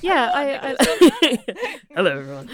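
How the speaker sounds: laughing. Real, not acted. Laughing at removing the mask.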